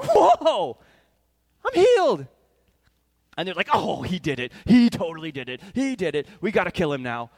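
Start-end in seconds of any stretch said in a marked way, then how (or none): 0.0-2.3 high, loud cry of wonder; amazed shout. Acted. Healed man shouting at his cure, amazed and loud. 3.7-7.3 loud, indignant Pharisee uproar; outraged shout. Acted. Pharisees reacting to the healing, loud outrage.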